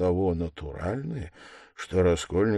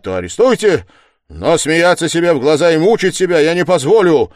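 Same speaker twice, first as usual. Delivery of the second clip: loud, forceful, building in pitch; furious declaration; 8 semitones higher, 12 dB louder, faster than usual. A narrator's reading. Suspect demanding an end to the mockery, loud fury. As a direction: declare it in rising rage, at full volume. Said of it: "глаза загорелись бешенством".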